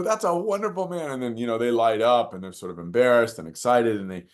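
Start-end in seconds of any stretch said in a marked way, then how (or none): 0.0-1.1 high-pitched acted call; re-enacted holler, pitched way up; retold yell. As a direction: call praise across the street, not at full volume. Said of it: "I yell across the street".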